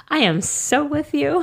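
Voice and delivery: laughing high, slowed down. Real, not acted. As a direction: laugh through it in agreement. Laughing aside to letter.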